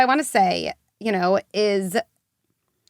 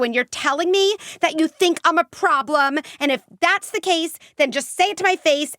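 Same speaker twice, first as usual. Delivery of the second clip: higher, defiant challenge to her parents; defiant teen; 6 semitones higher than usual. Retold or imitated. Teen defying her parents over therapy, bitter and challenging. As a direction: throw it back at them like a hurt teen.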